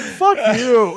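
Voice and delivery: high, loud mock snap; mock fed-up retort. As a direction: shoot back a fed-up curse. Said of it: "I'm just like".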